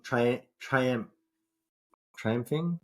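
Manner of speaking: usual speaking voice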